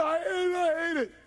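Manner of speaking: high, wailed loathing; anguished cry. Retold or imitated. Imitating the tormented smoker hating it, wailing.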